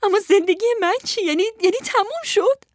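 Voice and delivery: high-pitched tearful wail; sobbing. Acted. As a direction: say it through sobs, distraught.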